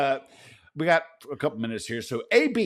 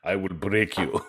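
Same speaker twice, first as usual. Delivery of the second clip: low Russian boxer menace; deep, cold Soviet fighter threat; Ivan Drago; 5 semitones lower than usual. Retold or imitated. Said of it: "Like I'm Ivan Drago from Rocky".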